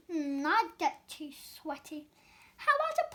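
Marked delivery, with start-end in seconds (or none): none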